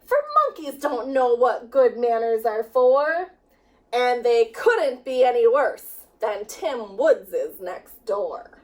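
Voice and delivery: usual speaking voice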